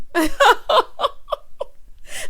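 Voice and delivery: high-pitched burst of laughter; big laugh. Real, not acted. Commentator cracking up at her own shout-out joke, laughing hard.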